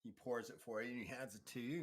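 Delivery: ordinary talk, nothing marked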